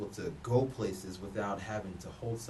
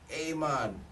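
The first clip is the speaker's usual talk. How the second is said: imitating a Jamaican friend's thick accent, rising and louder mid-line; playful Jamaican accent, laid-back and friendly; 5 semitones higher, 4 dB louder than usual. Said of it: "his accent was thick".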